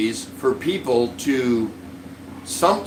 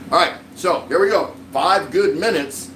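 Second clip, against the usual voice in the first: show intro; 5 semitones higher than usual. Acted. Launching his show segment.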